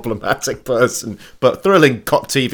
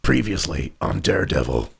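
previously on recap; 2 semitones lower than usual. Shown as a demonstration. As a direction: gravely announce the recap. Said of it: "your best previously on voice".

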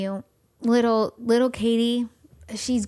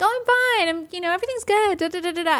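brushing it off; 9 semitones higher, much faster than usual. Retold or imitated. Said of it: "you're just like".

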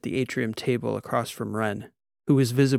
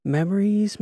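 high, lifted first memories; L remembers old times, high lifted memories; wistful sigh; 9 semitones higher, 4 dB louder than usual. A narrator's reading. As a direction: sing out the first word fondly.

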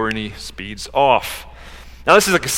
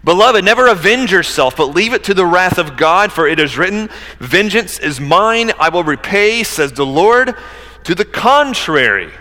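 emphatic, higher-pitched pulpit reading; scripture reading; 5 semitones higher, 5 dB louder than usual. Acted. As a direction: proclaim the verse with measured weight. Reads scripture aloud from pulpit.

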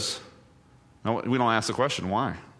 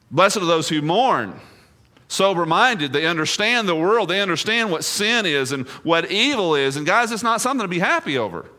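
higher, louder pulpit voice, impassioned; preaching at full force; 7 semitones higher, 5 dB louder than usual. Acted. Pastor grieving over the world to his church, preaching loudly. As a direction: plead it out over the lost.

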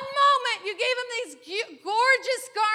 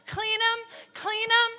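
ordinary talk

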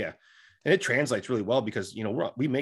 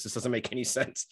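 laughing; 5 dB quieter than usual. Retold or imitated. Fighter's inner voice, laughing.

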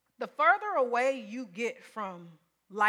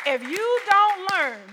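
slow, loud stress on don't; emphatic; 7 semitones higher, 8 dB louder, slower than usual. Real, not acted. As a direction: lean hard on "don't". Preacher drives the point home.

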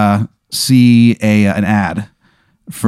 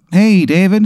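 cheery waitress greeting; 9 semitones higher than usual. Acted.

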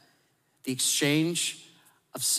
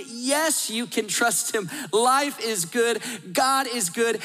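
higher, elated and a bit slower; overjoyed exclamation; 7 semitones higher, slower than usual. Pretend. Abraham, holding his son, answers a questioner, overjoyed. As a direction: gush with disbelieving joy, every line up high.

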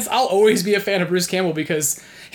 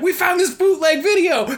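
excited; 10 semitones higher, 4 dB louder than usual. Retold or imitated. Excitedly telling Bruce their find.

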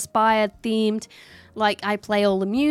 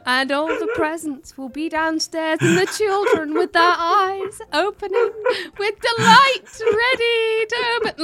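grand speech; 6 semitones higher, 6 dB louder than usual. Retold or imitated. Child on the stairs gives a pompous Christmas speech. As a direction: proclaim it like the Queen's Christmas speech. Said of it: "I did a whole speech".

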